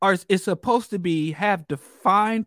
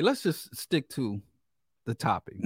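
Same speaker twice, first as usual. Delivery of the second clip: ordinary talk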